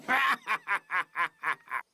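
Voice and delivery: evil villain cackle; diabolical laugh. Acted. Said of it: "Mi risa diabólica debió convencerte".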